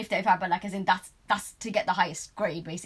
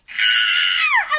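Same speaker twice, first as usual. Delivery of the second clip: high, loud mock scream; re-enacted scream; 10 dB louder than usual. Lifter reliving the bench press, piercing scream. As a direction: scream out as if straining under a weight. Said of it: "I just screamed".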